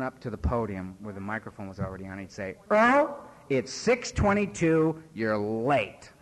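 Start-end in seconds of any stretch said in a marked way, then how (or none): 2.7-6.1 calls the name out loud, then slowly states the time. Retold or imitated. Sponsor scolding Earl over the mic, loud and slow. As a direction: public scolding over the microphone, drawn out.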